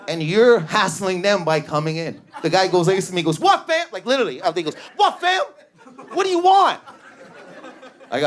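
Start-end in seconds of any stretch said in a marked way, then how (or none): 3.4-3.9 high, punchy heckle; hammed-up challenge, pitched way up; mock confrontation. Shop guy confronts customer. 5.0-6.8 hammed-up heckle, pitched way up; mock confrontation. Shop guy confronts customer.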